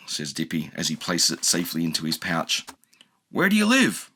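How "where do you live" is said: high chirpy question; little bird asking; bird creature. A narrator's reading. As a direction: ask brightly. Shishi voice.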